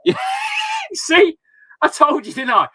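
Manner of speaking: loud and high, crowing at being proven right; a dad triumphantly teasing his little daughter; gleeful told-you-so. Real, not acted. Dad crowing to daughter who proved him right, loud and gleeful.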